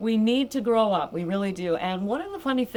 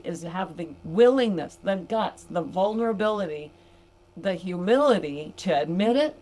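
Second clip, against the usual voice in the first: ordinary talk, nothing marked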